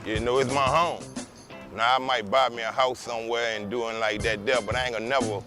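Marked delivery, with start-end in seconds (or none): none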